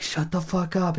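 high mock yell; angry yell. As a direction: tell the talker off. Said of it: "You're like".

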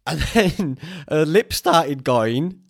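ordinary delivery

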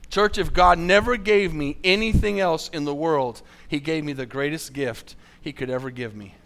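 ordinary talk

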